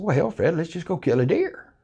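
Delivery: ordinary delivery, nothing marked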